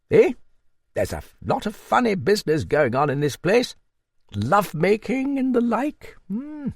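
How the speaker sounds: put-on high-pitched hearty old dame; old Duchess. Narrator voices the old Duchess.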